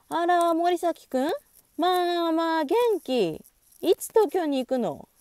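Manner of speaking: high, loud, chirpy auntie voice; carefree older lady. A narrator's reading. Friend's mother answering Morisaki's phone call, carefree and high. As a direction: greet a familiar caller with easy, drawn-out surprise. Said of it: "えらくのんきなリアクションだった".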